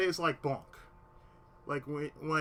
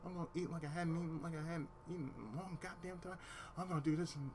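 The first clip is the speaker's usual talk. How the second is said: rapid, softly spoken inner rant; racing thoughts; 12 dB quieter, faster than usual. Retold or imitated. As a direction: rattle off hushed thoughts. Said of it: "this is everything in my head".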